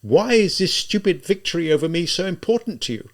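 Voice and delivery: high, loud, agitated outpouring; exasperated, anguished cry; near despair. A narrator's reading. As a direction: demand it desperately, pitched well up. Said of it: "with something almost akin to despair".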